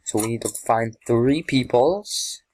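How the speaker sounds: ordinary talk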